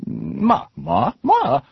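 "Ma, ma, ma" each with a different high tone; "Ma" sung high then shifting tone, three words; Mandarin tones on ma. Shown as a demonstration. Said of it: "three different words".

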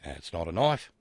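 bad Australian accent, knife rising as if dismissive. Host quotes Crocodile Dundee in a bad Australian accent. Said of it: "my bad Australian accent portion".

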